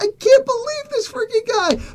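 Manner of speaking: very high, strained incredulity; hammed-up exasperated disbelief; squeaky disbelief. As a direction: marvel in a tiny high voice. Acted disbelief at the kid.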